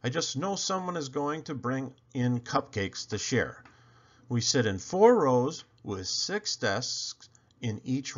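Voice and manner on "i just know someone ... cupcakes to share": usual speaking voice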